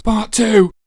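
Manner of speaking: loud, high-pitched bellow; shouted title announcement. Pretend. Reviewer announcing part two of the game, in a loud high shout. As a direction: yell it out like a big chapter heading.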